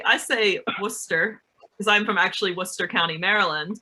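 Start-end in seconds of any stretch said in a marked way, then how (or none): none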